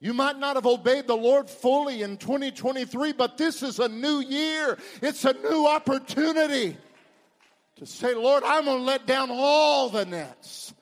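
high, loud, swelling pulpit delivery; ringing revival-preacher crescendo; full-force preaching. Real, not acted. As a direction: proclaim it in triumph, stretching the climax. Exhorts congregation about new year.

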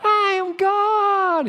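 very high, loud and slow, a hammed-up boast; mocking proud claim. Angels watch a man declare himself God, hammed-up high boast. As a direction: lampoon a puffed-up self-declared god. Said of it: "Michael, come and look at this".

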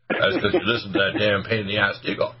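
usual speaking voice

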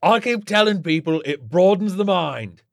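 loud, high, vindicated boast; triumphant shouting. A narrator's reading. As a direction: crow that you were right all along. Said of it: "Orlin shouted".

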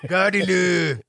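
lightly put-on Scottish call; a drawn Scottish take on the cry; Scottish accent. Host trying the cry again, light Scottish accent. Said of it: "a little bit different in the Scottish accent".

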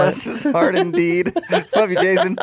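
high-pitched, laughing teasing affection; amused, laughing echo of the co-host. Real, not acted. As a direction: say it through giggles. Co-host laughing about their friend.

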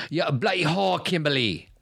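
high, hammed-up British soap voice; British accent. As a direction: spit it out like a soap villain. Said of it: "Shane Donovan talking to Kimberly".

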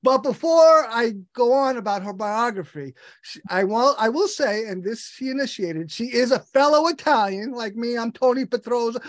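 ordinary talk

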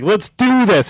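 high, eager hype voice; pumped up. Retold or imitated. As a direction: hype yourself up. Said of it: "He's like".

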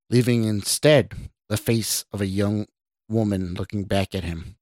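plain reading voice

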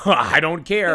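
loud, mocking "I don't care"; mock dismissive retort. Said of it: "Hey, you know what?".

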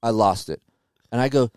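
normal talking voice

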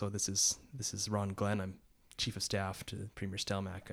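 quiet, solemn retelling of an official introducing himself; subdued, somber phone voice; somber caller. Retold or imitated. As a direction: softly imitate a grave caller, haltingly. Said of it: "really somber voice comes on the phone".

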